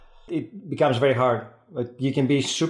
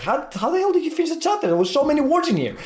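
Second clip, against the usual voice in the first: incredulous; faster than usual. Retold or imitated.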